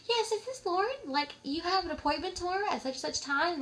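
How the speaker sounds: sweet phone-desk politeness; polite receptionist voice. Retold or imitated.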